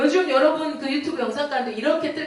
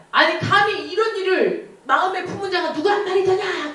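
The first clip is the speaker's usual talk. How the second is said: indignant king; 6 semitones higher than usual. Acted. King demands who plotted.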